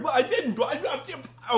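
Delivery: high, loud mock sputtering; hammed-up panicked stammer; flustered denial. Loki caught out by his accusers, flustered denial.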